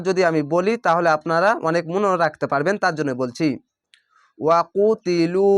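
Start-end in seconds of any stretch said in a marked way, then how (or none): 4.4-5.5 ওয়াকুতিলু slowly with long ু; Quranic recitation. Shown as a demonstration. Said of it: "এক আলিফ টেনে পড়বেন".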